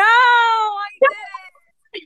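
high dismayed cry of realisation. Real, not acted. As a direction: cry out in mock despair. Realising mistake, crying out.

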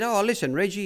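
high-pitched imitation of a trainer; Bart. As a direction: call for attention in a put-on high voice. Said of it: "He said".

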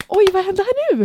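high gasp then exclaim; surprised gasp. Real, not acted. Reacting to her friend's big news, surprised.

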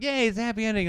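high-pitched mock celebration; mock cheering. Host mimicking the movie's happy ending, mock cheer.

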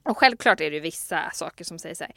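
usual speaking voice

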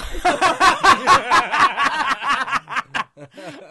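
a long, high, loud cackle; loud burst of laughter. Real, not acted. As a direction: crack up at your own joke. Laughs at own joke.

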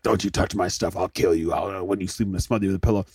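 low, quiet sibling threat; mock menace. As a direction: threaten under your voice, playfully sinister. Imitating hateful older sibling's threat.

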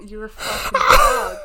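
shrieking, high and loud insults; shrieking jackpot winner. Real, not acted. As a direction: scream it through laughter, way up high. Screaming insults at teasing friend.

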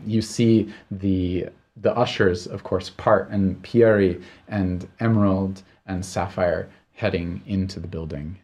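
ordinary talk

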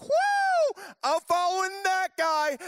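high, loud whooping fan; giddy crowd shouting its devotion; excited crowd cheer. Acted. Crowd cheering after exorcism.

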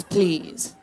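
normal talking voice

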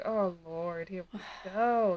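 low, soft weary sigh. Retold or imitated. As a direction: roll your eyes and sigh it. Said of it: "I was just like".

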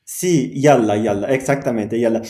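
usual speaking voice

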